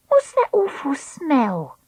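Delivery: put-on high, slow girl's voice; Treacle voice. Treacle's voice in the story.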